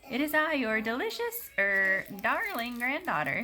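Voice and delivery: put-on wolf faking a high, slow girlish voice; the wolf pretending to be the granddaughter, high voice. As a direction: pitch it up high and slow to pass as the girl. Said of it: "said the wolf in a high voice".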